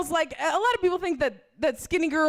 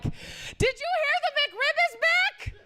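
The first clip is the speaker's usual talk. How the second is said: much higher, slow, panicky squeak; panicked blurt; 14 semitones higher, slower than usual. Retold or imitated. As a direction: blurt it out in a nervous high squeak. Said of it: "I panicked and said the first thing".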